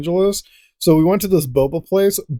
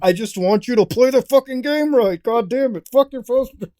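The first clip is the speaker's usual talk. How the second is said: higher, exasperated mock rant; frustrated gamer yelling at a superfan; mock angry gamer; 6 semitones higher than usual. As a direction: snap at the fan in mock fury. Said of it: "and then you're just like".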